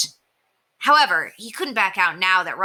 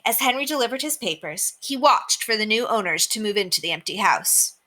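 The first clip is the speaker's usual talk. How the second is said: plain reading voice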